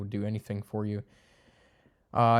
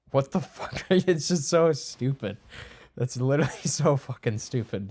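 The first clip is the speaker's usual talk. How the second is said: louder, laughing scorn at sports fans; laughing in disbelief; 3 semitones higher, 4 dB louder than usual. Real, not acted. Vlogger mocking sports-obsessed friends, laughing loudly. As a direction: laugh through the put-down.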